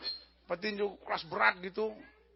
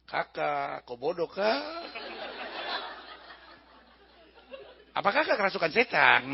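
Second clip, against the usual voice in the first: lilting mock Papuan accent; 6 semitones lower than usual. Papuan asking the king if he's possessed, comic Papuan accent. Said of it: "Kalau ada orang Papua di situ, dia bilang".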